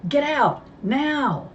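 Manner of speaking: high, loud command; injured man shouting to the kids; urgent shout. Acted. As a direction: order them out, frantic.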